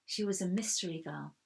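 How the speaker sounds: usual speaking voice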